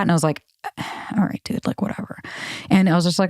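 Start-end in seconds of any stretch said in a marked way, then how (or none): 1.2-2.2 whispered, giving up; dismissive whisper. Retold or imitated. Brushing off the defensive guy, whispered. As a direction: let it drop under your breath.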